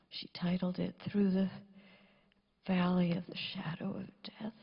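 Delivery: soft, near-whispered eulogy; too quiet. Real, not acted. Daughter eulogizing her father, so soft her mother cannot hear. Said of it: "I'll try to talk louder, Mommy".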